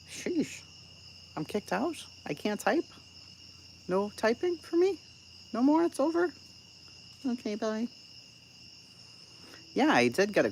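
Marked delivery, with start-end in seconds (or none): 0.1-7.9 tiny high voice, softly questioning; mock meek reaction. Streamer acts out being kicked from the chat, mock meek. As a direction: squeak out short, hurt little questions.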